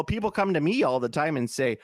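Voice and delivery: ordinary delivery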